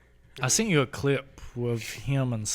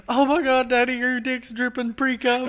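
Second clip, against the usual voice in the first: shocked high voice; 9 semitones higher, 3 dB louder than usual. Retold or imitated. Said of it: "he's like".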